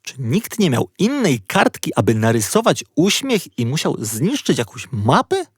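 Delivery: ordinary delivery, nothing marked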